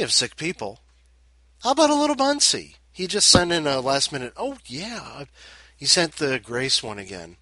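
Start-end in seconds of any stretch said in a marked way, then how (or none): 1.6-4.6 high, loud host intro; excited. Real, not acted. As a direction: announce it with a lift.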